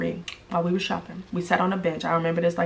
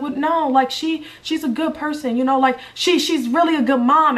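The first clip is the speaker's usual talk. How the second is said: much higher, flustered defense of her; defensive denial; 7 semitones higher than usual. Retold or imitated. Defending the ex to her friend, in a much higher flustered voice. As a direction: protest too eagerly. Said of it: "I was like".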